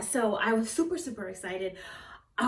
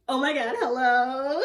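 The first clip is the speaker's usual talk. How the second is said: higher, louder, drawn-out hello; laughing, thrilled phone greeting; changed to excited; 4 semitones higher, 6 dB louder than usual. Shown as a demonstration. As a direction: greet them in gushing delight. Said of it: "Completely changed it".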